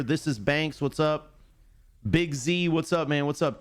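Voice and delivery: ordinary delivery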